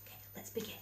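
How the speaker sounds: hushed whisper before the story starts. Real, not acted. Reader whispering to the children before beginning, hushed whisper. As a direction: breathe it, barely voiced.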